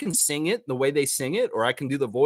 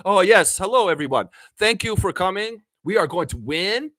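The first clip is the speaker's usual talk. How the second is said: higher, excited Masai Ujiri voice; Masai impression; 6 semitones higher, 3 dB louder than usual. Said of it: "When I do an impression of Masai".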